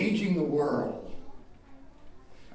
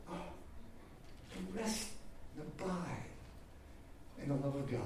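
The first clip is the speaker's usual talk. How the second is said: hushed awe; 12 dB quieter than usual. Real, not acted. Preacher savouring God's love.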